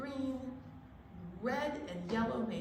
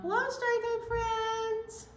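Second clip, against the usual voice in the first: high, squeaky puppet greeting; zebra puppet voice; 12 semitones higher, 4 dB louder, slower than usual. Pretend. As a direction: play a cheerful zebra puppet saying hello.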